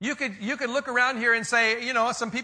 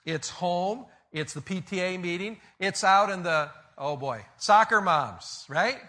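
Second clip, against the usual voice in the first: usual speaking voice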